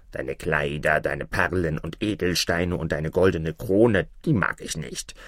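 low frog voice, turning down the offer; talking frog, pitched low and unimpressed; dismissive frog. Acted. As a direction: repeat the treasures low, then refuse. Frog refuses her treasures.

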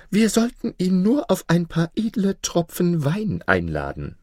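high-pitched, wheedling vizier; scheming vizier. Acted. Vizier proposes wine to loosen Maruf's tongue, scheming. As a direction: suggest the plot in a high voice.

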